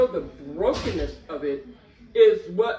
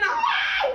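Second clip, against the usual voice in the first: piercing high scream, louder; high yell; 4 dB louder than usual. Retold or imitated. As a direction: cry out sharply. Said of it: "I started screaming".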